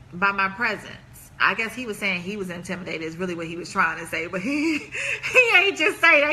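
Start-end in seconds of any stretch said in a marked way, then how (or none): 4.4-6.3 giggling over the words; words broken by real laughter; laughing through it. Real, not acted. To her viewers about the guy who called her intimidating, laughing.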